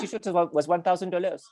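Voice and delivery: quick put-on French accent. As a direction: rattle it off in mock French. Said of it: "it'll be a French accent".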